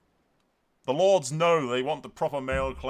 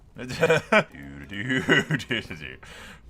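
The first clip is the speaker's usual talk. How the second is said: long cackling laugh; gleeful laugh; 5 dB louder than usual. Real, not acted. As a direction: cackle at the destruction. Gleeful villain after burning Pentos.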